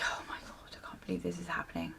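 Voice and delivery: soft rushed whisper; whispered disbelief. Retold or imitated. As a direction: whisper it, fast and stunned. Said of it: "unvoiced whisper".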